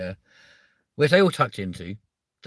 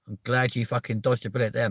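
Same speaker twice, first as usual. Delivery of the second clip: ordinary talk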